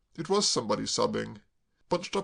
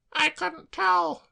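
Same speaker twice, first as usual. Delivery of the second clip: put-on much higher, slow wretched girl; tearful girl; 5 dB louder, much slower than usual. Claire sobbing to Braddock, high tearful wail.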